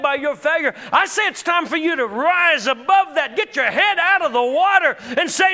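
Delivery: rising preacher's shout, loud and high; fiery pulpit preaching; preaching at full force. Real, not acted. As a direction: build the sermon to a roaring climax.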